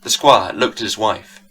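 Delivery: usual speaking voice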